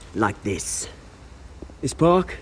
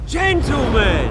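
calling out; 4 semitones higher, 4 dB louder, much slower than usual. Acted. Interrupting thugs, calling out.

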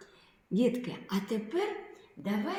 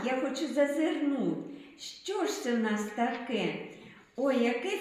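put-on higher, eager storyteller voice for kids; excited mystery; 6 semitones higher than usual. Teacher teasing children about the hidden object, excited and higher. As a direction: build curiosity about the bag.

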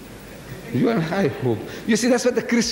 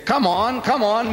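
higher, impatient acted prodding; impatient urging; 6 semitones higher than usual. Reverend presses the Maulana to answer, impatient. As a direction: hurry a stalling opponent along.

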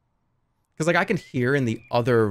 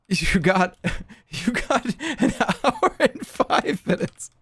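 high, slow words through laughter; real giggling breaking up the sentence; laughing; 10 semitones higher, much slower than usual. Real, not acted. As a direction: try to announce it while cracking up. Host laughs announcing time left.

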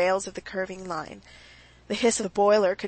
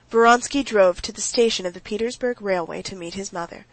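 plain reading voice

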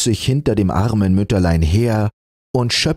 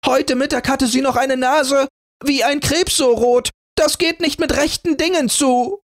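much higher angry accusation; screaming. A narrator's reading. Jealous sister accusing Rotnas, angry shriek. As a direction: spit out the accusation. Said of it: "schrie sie".

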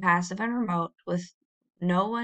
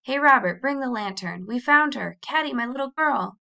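raised, loud, glad call; excited relief, pitched up; relieved calling out; 5 semitones higher, 5 dB louder than usual. A narrator's reading. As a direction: shout the good news to a helper. Relieved father calls out.

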